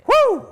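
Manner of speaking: high, loud "woo"; excited whoop. Real, not acted. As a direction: let out a piercing whoop. Excited whoop at running engine.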